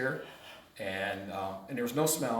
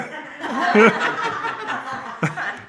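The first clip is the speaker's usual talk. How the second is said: loud laugh; 14 dB louder than usual. Real, not acted. Telling friends a funny story, loud laugh. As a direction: burst of high breathy laughter.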